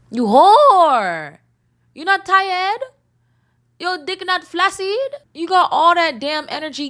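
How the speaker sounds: high mock outrage, lightly voiced; hammed-up scolding of a cheater.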